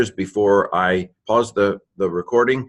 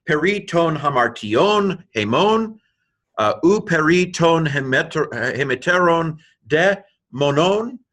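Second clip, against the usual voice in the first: high, slow recited Greek; measured, raised-pitch reading of Greek scripture; Greek verse recitation; 7 semitones higher than usual. Shown as a demonstration. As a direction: recite word by word, slowly and pitched up. Reads the whole Greek verse aloud.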